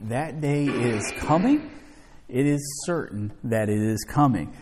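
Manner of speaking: normal talking voice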